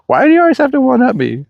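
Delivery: high-pitched mock complaint; put-on high voice, playfully protesting; mimicking his wife. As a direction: imitate a wife's complaint in a high voice. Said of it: "she was always like".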